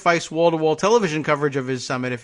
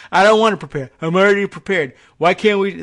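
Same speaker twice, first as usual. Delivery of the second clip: louder, higher whiny mock Trump; hammed-up Trump sulk; petulant Trump; 5 semitones higher, 7 dB louder than usual. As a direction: whine like a spoiled child.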